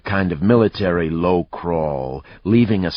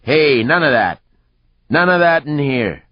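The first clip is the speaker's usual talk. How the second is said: high, loud warning shout; bartender's yell; 10 semitones higher, 7 dB louder than usual. A narrator's reading. Bartender stopping a bar fight, yelling. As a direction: yell it across the room to break up trouble. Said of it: "The bartender yelled".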